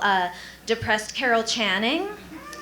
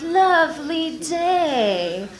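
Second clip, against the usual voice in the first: flirty; 6 semitones higher, much slower than usual. Retold or imitated. Flirting with cute guy.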